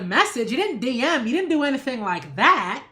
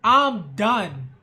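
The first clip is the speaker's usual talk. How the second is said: exasperated; 3 semitones lower than usual. Real, not acted. Fed up with defenders.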